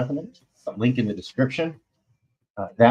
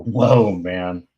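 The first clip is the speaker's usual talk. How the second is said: drawn-out amazed whoa with a loud start; stunned, like a pretty girl walked by; impressed whoa; 3 semitones lower, 4 dB louder than usual. Retold or imitated. A guy stunned by a strong woman, going whoa. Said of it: "makes you go".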